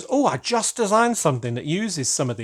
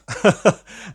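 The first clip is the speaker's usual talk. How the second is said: laugh; 5 semitones higher, 5 dB louder than usual. Real, not acted. Laughing at letter about decorating.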